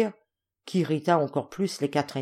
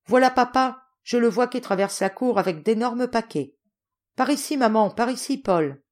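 excited calling; 6 semitones higher, 3 dB louder than usual. Acted. Child calls out seeing father arrive.

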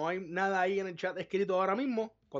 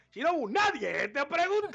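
high, louder teasing brush-off; mock snub; 11 semitones higher, 4 dB louder than usual. Host teasing a chat member who overshared, mock snub. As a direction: cut him down with a playful jab.

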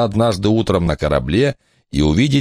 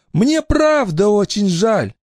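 mother voice; slower than usual. Acted. Mother apologises.

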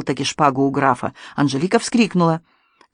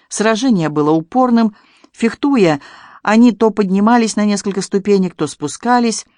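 plain reading voice

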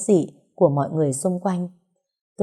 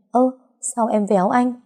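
mildly raised, protesting yelp; loud yelp; 6 semitones higher than usual. A narrator's reading. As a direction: cry out in pained protest, lightly acted. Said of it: "nhăn mặt kêu ầm lên".

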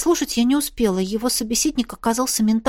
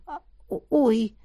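startled gasp; 3 dB quieter than usual. A narrator's reading. Sees dragon's bare chest.

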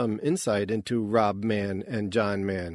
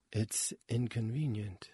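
sighed; 8 dB quieter than usual. A narrator's reading. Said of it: "the door sighed".

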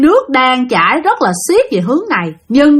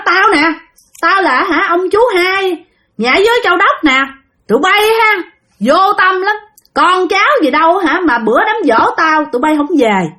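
higher, reproachful ghost uncle; possessed aunt; 6 semitones higher than usual. Retold or imitated. Dead uncle scolds relatives for missing his memorial, reproachful possessed voice. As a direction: scold the relatives from inside her body. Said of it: "Thì nói vậy đó".

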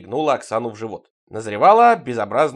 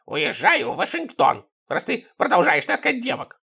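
put-on aggrieved old spy; 5 semitones higher than usual. A narrator's reading. Sidrych quits over the narrator bringing girls, aggrieved and high. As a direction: announce departure with an injured protest.

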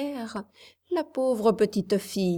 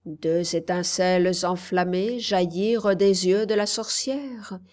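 ordinary delivery

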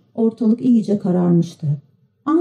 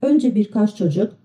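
ordinary delivery

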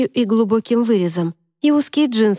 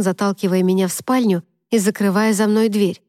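plain reading voice

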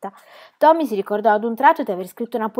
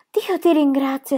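higher, slow sigh of relief; mock relieved Pippi; relieved exclamation; 6 semitones higher, slower than usual. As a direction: thank heaven with relief. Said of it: "esclamò Pippi".

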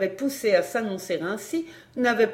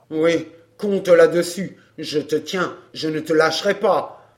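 low-pitched, grim threat; threatening man; 4 semitones lower, 5 dB louder than usual. A narrator's reading. Man vowing not to let her go, threatening. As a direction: dig in, low and insistent.

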